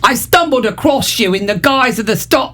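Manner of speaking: a high, hammed-up try at an Australian accent; reaching for an Australian accent and losing it; failed Australian accent. Host reading a fan comment in a bad Australian accent. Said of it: "I can't do an Australian accent".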